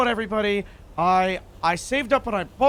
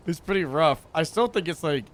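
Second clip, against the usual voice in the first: normal talking voice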